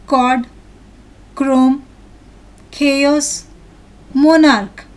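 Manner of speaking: each word alone with a hard "k", as in "chemical". Shown as a demonstration. Teacher reading the next picture's examples, firm word-list delivery. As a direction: read "chemical" to "monarch" one by one, with pauses. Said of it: "C H syllables are pronounced as k".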